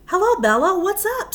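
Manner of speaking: high, loud cheerful call; Ben's friendly greeting. Acted. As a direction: greet her brightly as Ben. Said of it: "said Ben".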